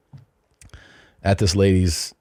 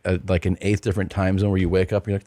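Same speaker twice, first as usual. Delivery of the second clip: normal talking voice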